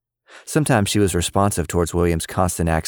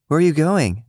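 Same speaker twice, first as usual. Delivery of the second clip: put-on high, eager little girl; chirpy kid asking a grown-up; girlish voice; 10 semitones higher than usual. A narrator's reading. Cicely asking Lily's mom, high and girlish.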